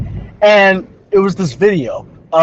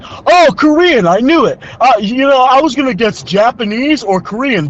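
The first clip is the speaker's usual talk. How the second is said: higher-pitched, overeager imitation; mock enthusiasm; 7 semitones higher than usual. Student imitating jogger guessing woman's origin, mock excited. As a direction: gush like a clueless guy pleased with his guess. Acts out man's clueless enthusiasm.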